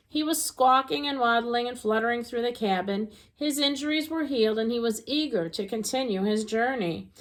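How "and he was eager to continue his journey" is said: ordinary delivery, nothing marked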